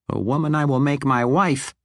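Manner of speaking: proud halfling speaking of his bride. Acted. Narrator voices the halfling Regis.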